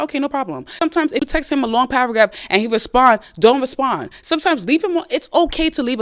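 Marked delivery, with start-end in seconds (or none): 0.0-0.7 higher, breezy brush-off; nonchalant. Pretend. Woman brushing off a sulking boyfriend, breezy and nonchalant. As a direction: shrug it off lightly. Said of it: "we're like".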